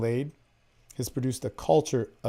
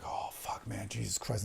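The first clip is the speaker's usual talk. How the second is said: whispered, low and far softer; breathy aroused whisper; 5 semitones lower, 16 dB quieter than usual. Retold or imitated. Retelling a kiss on his neck, whispered aroused gasp. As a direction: let it slip out in a whisper.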